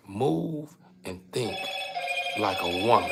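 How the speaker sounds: slow, drawn-out words "move and think"; slow emphasis. Acted. Speaker stressing his point to listeners, slowly. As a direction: lean on "move" and let it sink in.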